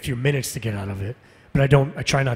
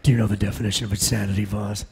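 low, quick, restrained hero voice; Adam Jensen; 4 semitones lower than usual. Acted. Actor answering a fan's request as Jensen, low controlled voice. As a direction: keep it low and cool, dropping at the end. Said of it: "we have that as Jensen".

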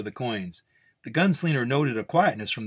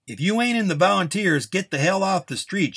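high, raised shout; 8 semitones higher, 4 dB louder than usual. A narrator's reading. Nun clears onlookers off the street, shouting. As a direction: order bystanders away at a shout. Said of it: "she shouted".

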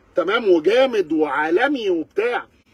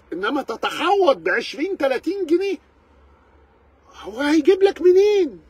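indignant outburst; 8 semitones higher than usual. Real, not acted. Indignant rant about prices.

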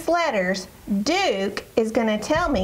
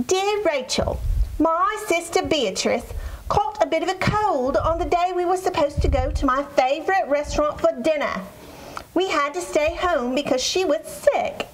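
put-on pitched up, a light British accent reading a child's letter; 4 semitones higher than usual. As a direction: read it as a prim British girl. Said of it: "that was an awesome British accent".

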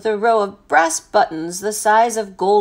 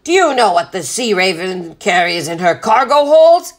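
loud, blustering sea captain; captain thundering about his rival; bellowed question; 6 dB louder than usual. A narrator's reading. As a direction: bellow it indignantly. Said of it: "Captain Swain bellowed".

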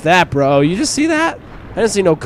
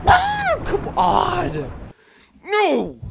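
very high, squealing cries; panicked protest; 14 semitones higher, much slower than usual. Real, not acted. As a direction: wail it in high-pitched panic. Shark attack in game.

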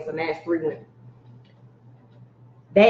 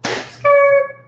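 high, loud screeching tire noise; 7 dB louder than usual. Retold or imitated. Retelling a cop revving at protesters, sharp tire screech. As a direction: mimic a car peeling out. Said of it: "the officer was revving his engine".

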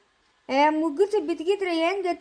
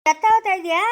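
scolding shout; 5 semitones higher, 6 dB louder than usual. Acted. Scolding in a quarrel.

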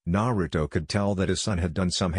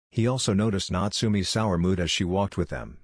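ordinary delivery, nothing marked

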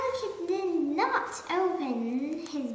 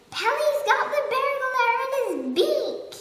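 fast, much higher, louder; monkey gleefully shouting the news; excited; 9 semitones higher, 6 dB louder, faster than usual. Acted. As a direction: burst with delight. Monkey celebrating the capture.

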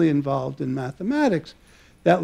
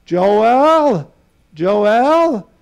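put-on heavy Hungarian accent, pitched higher and louder; an eager Hungarian accent, calling a friend awake at dawn; Erdős's Hungarian accent; 5 semitones higher, 6 dB louder, much slower than usual. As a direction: call through the bedroom door in a thick Hungarian accent. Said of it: "I'll try and do the Hungarian accent in English".

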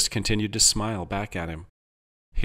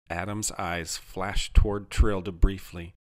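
ordinary delivery, nothing marked

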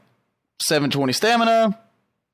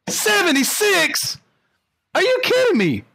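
high-pitched, incredulous outburst; shocked disbelief; 13 semitones higher than usual. Real, not acted. Player balking at the creature's high level, incredulous. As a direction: react in real disbelief, voice shooting up.

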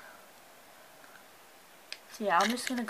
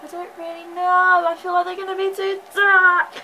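higher, louder, anxious whine; real nervous worry; 7 semitones higher, 10 dB louder than usual. Real, not acted. Girl dreading her tinted eyebrows, anxious whine. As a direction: fret out loud about the result. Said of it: "I'm actually really scared".

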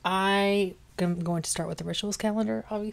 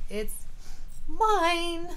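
voicing the cat; 10 semitones higher than usual. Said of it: "He's like".

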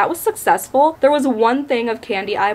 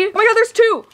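excited squeal; 11 semitones higher than usual. Real, not acted. Excited unboxing.

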